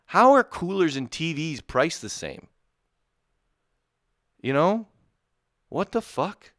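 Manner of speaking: high, loud incredulous rant; outraged disbelief. Real, not acted. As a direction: squawk it in disbelief. Ranting about cooler prices.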